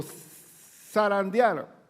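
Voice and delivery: zarandear stretched very slowly, each syllable long; drawn-out word. Shown as a demonstration. The preacher explains the sifting, drawing out the word slowly. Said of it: "desgranar el trigo".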